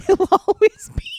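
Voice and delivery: high and loud through laughter; laughing. Real, not acted. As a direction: crack up while reading it. Reading breaks into laughter.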